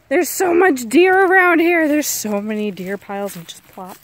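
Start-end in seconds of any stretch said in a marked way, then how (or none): none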